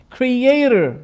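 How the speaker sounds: "Creator" with an American r at the end; American pronunciation, r sounded at the end of "Creator"; American accent. Shown as a demonstration. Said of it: "in American accent".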